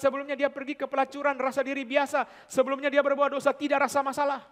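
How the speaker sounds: loud, high, fervent preaching; preaching at full force. Acted. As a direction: build the sermon to a fiery peak. Preaching climax.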